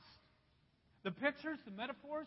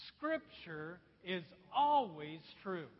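slow, louder, read in one voice; unison reading; 4 semitones lower, 6 dB louder, much slower than usual. Shown as a demonstration. Preacher leading the congregation in unison, slow and loud. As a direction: read it aloud slowly with the whole room. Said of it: "Let's all read this in one voice".

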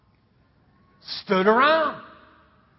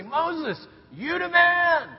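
high mock holler of praise; crowd cheer; 8 semitones higher, much slower than usual. People everywhere hail Moses, high cheering call. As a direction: hail him like an adoring crowd. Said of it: "people would say".

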